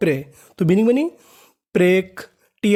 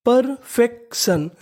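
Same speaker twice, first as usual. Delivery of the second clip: Perfection said slowly and a bit higher, every syllable clear; slow careful word; 4 semitones higher, much slower than usual. Shown as a demonstration. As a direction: draw out perfection for learners. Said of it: "तो meaning बनी". (धीरे-धीरे साफ़ उच्चारण)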